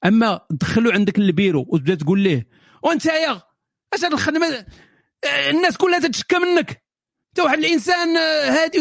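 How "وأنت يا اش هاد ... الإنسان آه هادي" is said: mock berating shout. Boss yelling at a worker in his office, angry shout. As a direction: tear into the worker like a bad boss.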